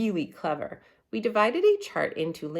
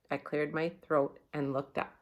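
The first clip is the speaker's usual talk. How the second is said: plain reading voice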